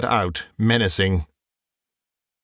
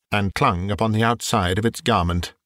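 ordinary delivery, nothing marked

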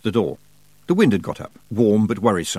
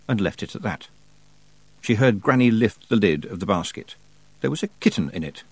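plain reading voice

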